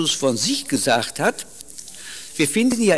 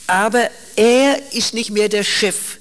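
normal talking voice

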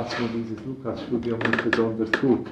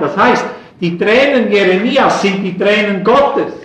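loud, high impassioned preacher voice; fervent preaching; 7 semitones higher, 8 dB louder than usual. Acted. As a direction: preach it at full force from the pulpit. Preacher rising to passion.

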